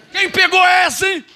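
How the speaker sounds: high, loud shout to the congregation; exultant preacher shout; shouted call to crowd. Real, not acted. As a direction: call out to the church.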